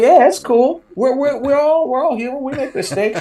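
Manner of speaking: very high, hammed-up forgiving voice; comic high-pitched reassuring act; overeager reassurance. Acts overeager reassurance to embarrassed woman.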